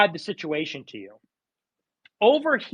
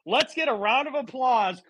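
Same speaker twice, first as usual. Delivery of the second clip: normal talking voice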